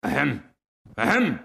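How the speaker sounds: mildly angry double 'ahem'; annoyed throat-clearing. A narrator's reading. Rocket unable to get a word in, mildly angry. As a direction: cut in with an irritated little cough. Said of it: "said the Rocket angrily".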